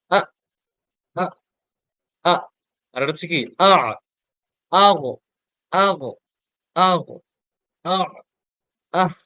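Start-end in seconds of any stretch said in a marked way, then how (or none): 0.1-2.3 short clipped আ repeated; sakin drill. Shown as a demonstration. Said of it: "হামজা". 3.6-9.1 আগো stopped hard on the letter; sakin drill. Shown as a demonstration. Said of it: "হ্যাঁ, এভাবে".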